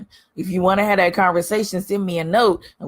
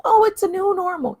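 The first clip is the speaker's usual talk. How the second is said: high, sing-song mock voice; mocking a platitude; 12 semitones higher, 3 dB louder than usual. Mocking what people told her, in a high sing-song voice. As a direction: parrot the cliché in a high mocking tone. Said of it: "I've told people".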